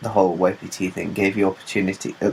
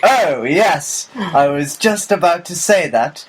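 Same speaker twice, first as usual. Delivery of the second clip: high, grandly put-out stage delivery; mock theatrical comeback; 8 semitones higher, 3 dB louder than usual. Upstaged actor covering for a stolen line, mock theatrical. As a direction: act it up like an interrupted scholar.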